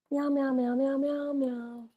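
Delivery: quiet high-pitched meowing; soft high meows. Real, not acted. Owner meowing softly to her cat, high and quiet. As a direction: meow gently at the cat.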